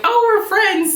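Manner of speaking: high, sheepish self-imitation; awkward high voice. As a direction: squeak it out awkwardly. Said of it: "I'm in an interview like".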